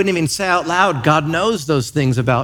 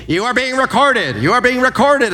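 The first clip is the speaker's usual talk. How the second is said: raised, insistent automated warning; store security screen; 7 semitones higher than usual. Retold or imitated. Screen speaking to a Home Depot shopper, insistent warning. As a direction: imitate a talking surveillance screen, higher and insistent. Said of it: "it speaks to me".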